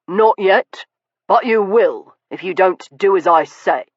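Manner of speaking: cold, hard interruption; ruthless cut-in. Acted. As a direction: Stoker overriding the butler. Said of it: "Stoker cut in ruthlessly".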